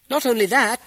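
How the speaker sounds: high, loud cut-in from the crowd; loud interruption. A narrator's reading. Second townsman interrupts to pile on, loud heckle. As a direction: shout over the first speaker. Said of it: "interrupted another".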